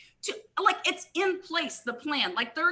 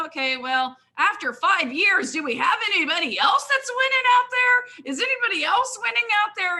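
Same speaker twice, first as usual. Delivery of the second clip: exasperated, pushing higher with each question; exasperated rising plea; 8 semitones higher, 5 dB louder, faster than usual. Retold or imitated. Frustrated distributor pleading at a stale company, rising higher. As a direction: voice climbing higher and louder, pleading. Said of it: "And I'm like".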